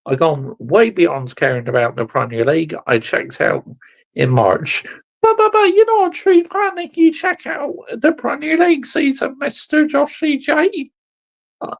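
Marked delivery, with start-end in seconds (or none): 5.2-10.9 high, whiny mock voice of a defending fan. Ranting fan mimics rival fans' excuses, whiny mock voice. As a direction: sneer it like a whining apologist. Mocking voice of a critic.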